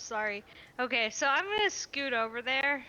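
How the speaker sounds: ordinary talk, nothing marked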